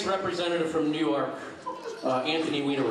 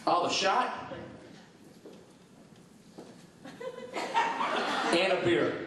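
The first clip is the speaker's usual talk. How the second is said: deadpan bear ordering with a huge gap after "shot"; long pause. Acted. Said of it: "What's with the long pause?".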